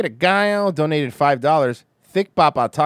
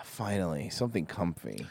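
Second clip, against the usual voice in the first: soft low voice; 9 semitones lower, 9 dB quieter than usual. Acted. Soft mock seductive invitation.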